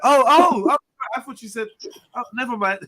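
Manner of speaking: high, loud flustered backpedal; flustered mock woman. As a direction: bluster it out, embarrassed. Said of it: "And like".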